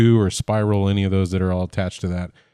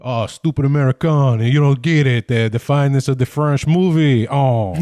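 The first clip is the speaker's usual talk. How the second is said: 1 mock snobby French critic; 3 semitones higher than usual.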